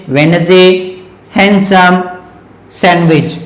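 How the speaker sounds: वेनजे, हैंडसम, सैंडविच, all without the d; silent D. Shown as a demonstration. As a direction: drop each d: "wen-zay, han-sum, san-wich". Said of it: "इसे क्या पढ़ेंगे".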